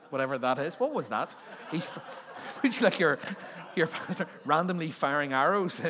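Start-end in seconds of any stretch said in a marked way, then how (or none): 1.9-2.6 short unvoiced laugh. Real, not acted. Laughing at own joke.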